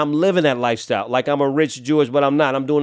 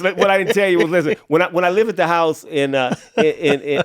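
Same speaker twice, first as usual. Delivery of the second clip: laughing excitedly; 6 semitones higher, 5 dB louder than usual. Real, not acted. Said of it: "listener laughs".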